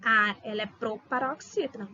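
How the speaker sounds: ordinary talk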